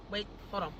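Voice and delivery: ordinary delivery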